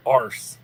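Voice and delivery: "Arse" said with a broad ah and no r-color, British style; "Arse" spoken as the Brits say it, loud. Shown as a demonstration. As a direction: say "Arse" the British way, long open ah. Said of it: "arse is how they say ass".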